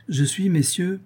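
high-pitched, self-satisfied announcement; slightly smug. A narrator's reading. Marquis boasting to his supper guests, slightly smug. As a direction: address them with a touch of conceit. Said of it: "avec un grain de fatuité".

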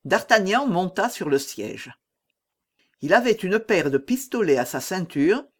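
usual speaking voice

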